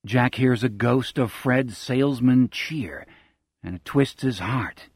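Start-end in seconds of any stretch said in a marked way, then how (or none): none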